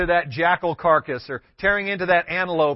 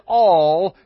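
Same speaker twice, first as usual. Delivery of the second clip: "all," punched louder than the words around it; the key word, pressed home; stress on all; 5 dB louder than usual. Shown as a demonstration. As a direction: hit "all," hard. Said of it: "when God's Word says".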